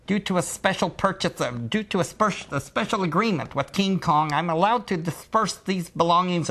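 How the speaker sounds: high-pitched lisping salesman voice; lisping car dealer. Retold or imitated. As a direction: pitch way up with a lisp. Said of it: "to use his voice".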